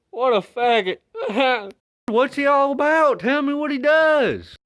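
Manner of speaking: high, loud disbelieving bro; shocked bro voice. Pretend. Acted dialogue between two dudes.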